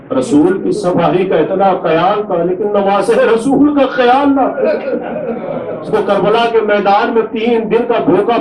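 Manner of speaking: keen the tragedy aloud to draw the mourners to tears; masaib lament. Acted. Preacher to mourners at a majlis, high wailing lament.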